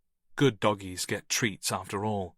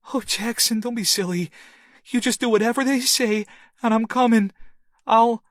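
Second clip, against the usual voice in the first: comforting; 13 semitones higher, 6 dB louder than usual. Acted. Comforting scared child by phone.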